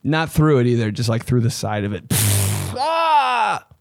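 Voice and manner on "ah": high shouted mock scream. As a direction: yell like driving through a car wash. Mimes screaming in car wash.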